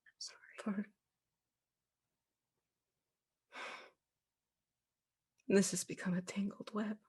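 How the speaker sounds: soft, broken whisper; sad whisper. Acted. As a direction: breathe it with regret. Ghost's sorrowful hushed reply.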